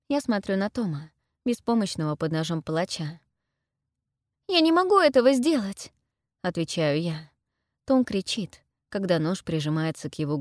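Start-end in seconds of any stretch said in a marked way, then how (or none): 4.5-5.9 high, loud, pleading refusal; urgent high protest; distressed refusal. A narrator's reading. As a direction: protest desperately, voice raised. Heroine refuses under threat.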